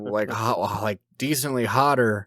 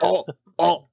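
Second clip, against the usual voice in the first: put-on startled drunk boss; 7 semitones higher than usual.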